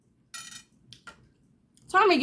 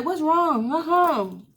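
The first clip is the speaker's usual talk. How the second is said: higher, urgent repeated question; alarmed mother; 8 semitones higher, slower than usual. Retold or imitated. Mother rushing to her screaming daughter, alarmed. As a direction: ask fast like a worried mom. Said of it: "So I was like".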